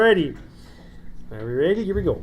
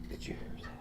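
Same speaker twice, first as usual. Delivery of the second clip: hushed aside, breath only; a quick secret whisper to the table; 21 dB quieter, much faster than usual. Real, not acted. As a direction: whisper it so the quizzers won't hear. Aside while quiz timer runs.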